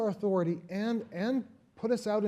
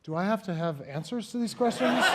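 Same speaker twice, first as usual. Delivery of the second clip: ordinary delivery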